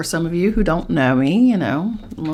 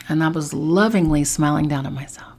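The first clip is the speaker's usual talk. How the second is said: ordinary talk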